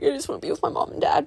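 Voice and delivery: imitating a clingy child's plea; crybaby. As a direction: rush it out as a needy little crybaby, mocking. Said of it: "I was a little crybaby at home".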